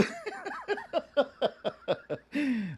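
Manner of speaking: real high-pitched giggling; high giggle. Real, not acted. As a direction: let the giggle run out. Continues laughing at clip.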